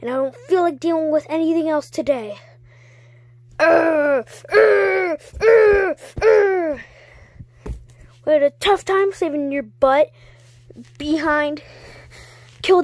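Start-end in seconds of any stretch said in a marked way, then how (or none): 3.6-6.8 grunts climbing higher and louder; escalating effort noises ending in a shout; building strained grunts. Acted. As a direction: strain harder with each grunt. Character strains in a rising outburst.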